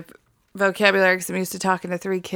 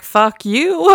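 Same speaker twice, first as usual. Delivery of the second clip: put-on defiant child retort; 5 semitones higher, 5 dB louder than usual. Child's retort voiced by parent.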